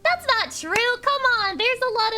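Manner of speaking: high, loud whine of protest; exclaimed high-pitched denial; indignant protest. Real, not acted. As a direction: object loudly, voice shooting up. Protesting chat's teasing.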